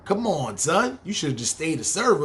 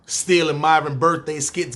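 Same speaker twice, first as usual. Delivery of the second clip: ordinary talk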